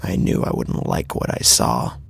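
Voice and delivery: plain reading voice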